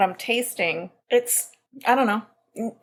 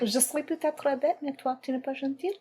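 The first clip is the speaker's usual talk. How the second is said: French accent; 4 semitones higher, 7 dB quieter than usual. Shown as a demonstration. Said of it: "I can't do French".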